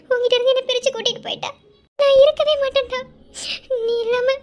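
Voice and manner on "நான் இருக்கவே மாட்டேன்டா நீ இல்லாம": louder, higher sobbing cry; crying out in anguish. Acted. Fearing she'll be taken from her husband, she cries out loud. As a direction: let it burst out through tears.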